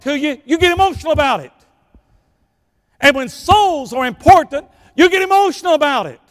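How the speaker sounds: high, loud, building pulpit fervor; full-force preaching. Real, not acted. Preacher urging church to care about souls, building full-force preaching.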